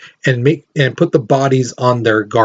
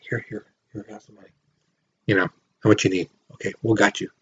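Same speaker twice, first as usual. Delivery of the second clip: much softer, hushed and quick; secret whisper; 5 semitones lower, 9 dB quieter, much faster than usual. Retold or imitated. America secretly hands Britain money, hushed and quick. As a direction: slip them money in a hushed aside. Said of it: "But secretly".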